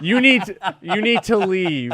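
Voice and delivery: mock stern officer; stern cop. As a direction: lay down the law like a cop.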